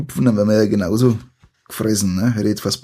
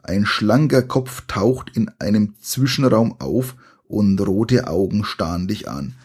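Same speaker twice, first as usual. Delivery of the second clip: usual speaking voice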